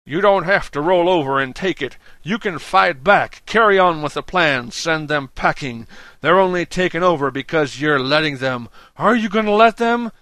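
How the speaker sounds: loud, high rallying cry; shouting. A narrator's reading. Dan rallies the cast members, shouting. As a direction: whip the crowd up at full voice. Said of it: "he shouted back".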